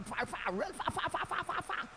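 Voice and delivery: airy emphatic far far far; trailing exaggeration. Real, not acted. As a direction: let each far drift further off. Exaggerating how far away.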